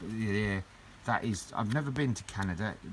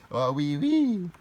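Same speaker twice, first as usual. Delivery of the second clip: mock French; 7 semitones higher, 7 dB louder, much slower than usual. Mock French after laughing.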